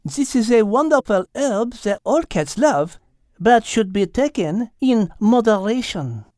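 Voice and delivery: almost matter-of-fact. A narrator's reading. Mademoiselle explains catnip to Al, almost matter-of-fact. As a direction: explain it evenly in a French accent. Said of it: "in almost a matter-of-fact tone".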